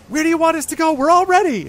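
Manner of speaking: high, loud, eager goblin shout; Gleek. Acted. Goblin asks his boss for orders, high yelling. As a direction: yell up at the boss in a high character voice. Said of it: "like, yelling at the bush".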